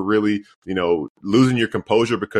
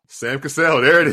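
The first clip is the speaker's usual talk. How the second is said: excited reveal; 7 semitones higher, 4 dB louder than usual. Real, not acted. Host celebrating co-host's quiz answer, excited and loud. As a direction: pitch jumps up, loud and triumphant.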